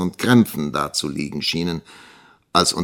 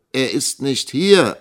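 slow, raised reply; answering; 7 semitones higher, 5 dB louder than usual. A narrator's reading. Young teacher answering the schoolmaster about the missing boy, measured reply. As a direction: state it slowly up to the caller. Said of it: "antwortete Nikolas".

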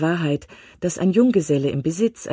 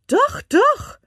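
eager insistence; 6 dB louder than usual. A narrator's reading. Wife insists to husband.

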